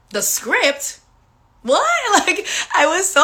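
high, loud the script? what?; disbelief, then a laugh; amazed as if she said something absurd; incredulous surprise. Retold or imitated. Shocked at friend's script.

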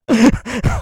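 high loud burst of laughter. Real, not acted. Laughing at the sirloin sons nickname, high burst of laughter. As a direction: cracks up at the nickname. Cracking up at own joke.

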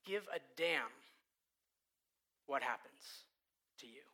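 quiet blunt. Retold or imitated. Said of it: "they are saying".

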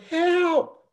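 put-on high little girl's voice calling help; child calling for help. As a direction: call out like a stuck little girl. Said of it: "Angie heard somebody".

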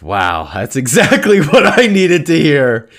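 moved amazement. Retold or imitated. Retells moved reaction, voice leaps up.

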